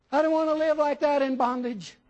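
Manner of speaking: high, loud mock complaint; acted indignant whine; protesting objector. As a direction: protest loudly as the put-upon listener. Voices an objecting church member.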